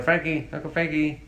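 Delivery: put-on high-pitched toddler voice; high, childlike calling; imitated little child. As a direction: imitate a small girl calling out. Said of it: "too much for her to say uncle".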